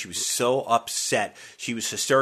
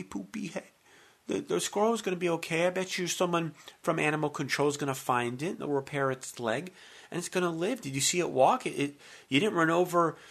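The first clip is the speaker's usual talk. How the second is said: put-on soothing baby talk; 7 semitones higher than usual. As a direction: coo to her like a child. Comforting wife, baby talk.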